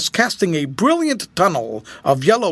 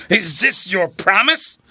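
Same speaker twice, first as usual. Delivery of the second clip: gasping, choking; 4 semitones higher than usual. A narrator's reading. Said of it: "in a gasping, choking voice".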